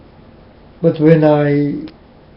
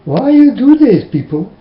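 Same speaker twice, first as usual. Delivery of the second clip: exasperated plea; 7 semitones higher, 4 dB louder than usual. Real, not acted. Pleading with the audience.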